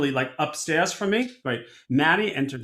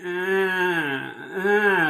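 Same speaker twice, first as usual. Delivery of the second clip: exaggerated nasal "eh" noises; Miriam. Upstairs neighbor's habitual noise, exaggerated nasal imitation. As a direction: whine out two drawn-out ehs like the neighbor. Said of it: "I always go".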